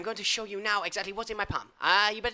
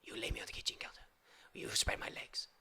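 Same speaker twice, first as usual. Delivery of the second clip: whisper; 13 dB quieter than usual. Acted. Sultry aside.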